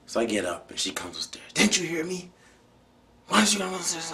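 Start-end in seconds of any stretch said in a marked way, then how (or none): 1.5-4.1 higher, much louder scolding yell; mother yelling. Retold or imitated. Mother yells at her son for coming upstairs, loud and high. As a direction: shout it down at him like an angry mom.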